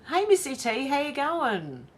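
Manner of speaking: ordinary delivery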